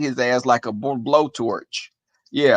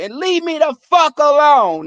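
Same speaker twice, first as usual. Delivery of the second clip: high, loud warning at the beaten bully; retold yell; 10 semitones higher, 4 dB louder than usual. Nine-year-old yelling at the beaten bully to back off, loud. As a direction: bark it down at the kid you just whipped.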